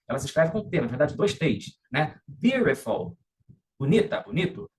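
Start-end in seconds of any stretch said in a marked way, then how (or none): 2.3-3.2 "Beautiful" said "biurefol"; American T as R. Shown as a demonstration. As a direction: flap the T in "Beautiful". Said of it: "essa pronúncia é típica do inglês americano". ("Beautiful" com T soando como R)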